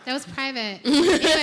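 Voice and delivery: laughing loudly, high and loud. Real, not acted. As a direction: laugh through the words. Laughing after teasing remark.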